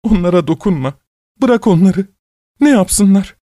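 put-on high-pitched imploring old woman; tearful pleading. A narrator's reading. Weeping old woman begging him to spare thieves, pleading. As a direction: beg for mercy on their behalf. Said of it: "yalvaran gözlerle bana bakarak ağlıyor".

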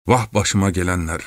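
plain reading voice

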